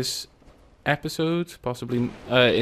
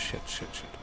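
whispered swearing; 13 dB quieter than usual. Real, not acted. Sneaking past enemy, swearing under breath.

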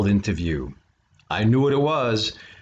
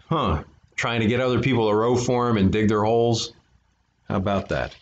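ordinary delivery

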